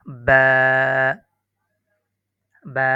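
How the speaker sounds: বা held for one beat, clearly pronounced; বা, drawn out by one measure; one-alif stretch. Shown as a demonstration. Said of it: "বা উচ্চারণ করতে এক আলিফ টান হবে". (বা এক আলিফ টেনে উচ্চারণ)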